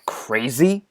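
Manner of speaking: offended echo. Acted. Offended echo before a tirade.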